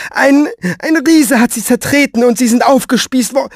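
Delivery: high, loud, stammering start; panicky speculation. Acted. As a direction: tumble into horrors until cut off. Woman fears worst until cut off.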